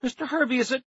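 high stammer into disbelief; stammering shock. A narrator's reading. Max sees the witness is a chimp, stammering shock. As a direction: trip over the words, then blurt it. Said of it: "I stammered".

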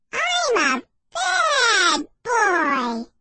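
put-on high, very slow baby voice; squeaky babyish dummy voice; shrill baby voice. A narrator's reading. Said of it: "the dummy said in a shrill baby voice".

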